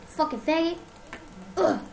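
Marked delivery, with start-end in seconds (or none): none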